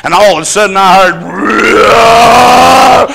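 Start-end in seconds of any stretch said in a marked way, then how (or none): 1.2-3.1 loud, high, drawn-out growling roar; imitated roar. Preacher to congregation, mimicking the dying man, loud roar. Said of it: "growling at me".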